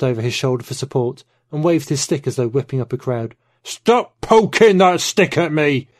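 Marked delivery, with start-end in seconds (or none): 3.6-5.9 higher, louder and slow, a furious yell; angry outburst. Acted. Man yells at old rival jabbing a walking stick, angry. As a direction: snap at him to stop poking.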